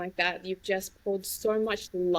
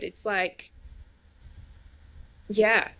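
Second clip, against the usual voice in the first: ordinary talk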